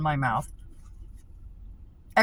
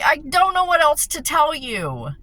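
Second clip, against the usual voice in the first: exasperated, raised; 7 semitones higher, 6 dB louder than usual. Retold or imitated. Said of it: "I'm like".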